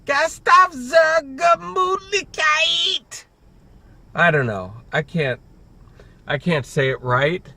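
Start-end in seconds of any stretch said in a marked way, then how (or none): 0.1-3.2 slow, much higher hammed-up German accent on Gasthof zur Gemütlichkeit; mock German accent. Shown as a demonstration. Vlogger retries the restaurant name in a playful mock German accent. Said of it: "maybe if I say it in a German accent".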